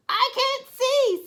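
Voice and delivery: high, loud and slow; frightened snail. Acted. Snail lost in the dark seaweed, high frightened cry. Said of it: "said the snail".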